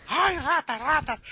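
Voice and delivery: high, hammed-up alarm cry; mock panic. Frightened spectators warn the captain, high mock panic. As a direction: yelp a warning about the rats. Crowd panics at imaginary rats.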